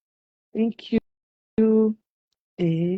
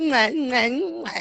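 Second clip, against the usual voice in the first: kiss sounds; 4 semitones higher, much slower than usual. Shown as a demonstration.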